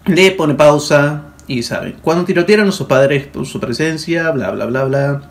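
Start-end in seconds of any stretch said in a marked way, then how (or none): none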